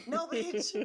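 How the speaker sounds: sassy retort. Retold or imitated. Retorting to imagined condescension.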